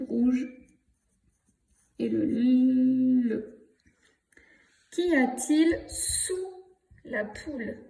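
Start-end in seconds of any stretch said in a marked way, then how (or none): 2.1-3.6 very slow, sustained "l" sound in "le"; sounding out letters. Shown as a demonstration. Teacher sounding out a letter for pupils, long held "l". 4.9-7.8 slow and higher, word by word; slow dictation. Shown as a demonstration. Teacher reciting the poem for pupils to write, slow dictation.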